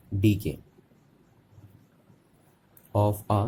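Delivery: slow dictation, word by word with long pauses; measured teacher's dictation. Shown as a demonstration. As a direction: dictate for note-takers in small separate chunks. Dictates a definition for writing.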